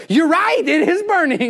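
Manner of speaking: high and loud with eager delight; a preacher thrilled at a patient's burning foot; excited exclamation. Retold or imitated. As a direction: pitch it up and cheer the news.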